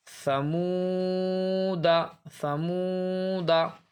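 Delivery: very slow recitation of समूदा, the final alif silent; Quranic recitation. Shown as a demonstration. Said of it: "silent है".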